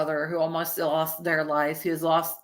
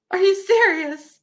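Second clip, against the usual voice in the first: high, louder hammed-up disbelief; mock disbelief; 11 semitones higher, 5 dB louder than usual. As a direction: act appalled at a lover's betrayal, voice pitched up. Acts out disbelief at lover's marriage.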